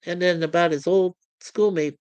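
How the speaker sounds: ordinary delivery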